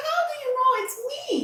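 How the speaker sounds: mock amazed; surprised question. Guest retelling how she asked her mentor. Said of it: "I was like".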